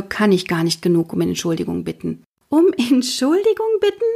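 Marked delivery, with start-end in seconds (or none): 2.5-4.0 put-on a girl bursting out in amazement; blurted disbelief. Mathilda echoes the detective's apology in high surprise. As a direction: echo it much higher, astonished. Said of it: "entfährt es mir".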